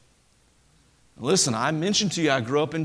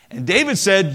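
ordinary talk

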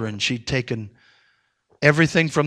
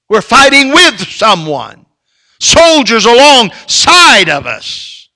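full-force preaching; 6 semitones higher, 12 dB louder than usual. Acted. Pastor rallying fellow soldiers in church, loud high preaching. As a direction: swell up and shout it to the rafters. Preacher rises to climax again.

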